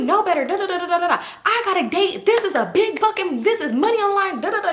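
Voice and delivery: high-pitched mock nagging rant with da-da filler; talking shit. Mad friend complains about losing money, mock ranting. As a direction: imitate a friend griping on and on. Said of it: "She just talking shit".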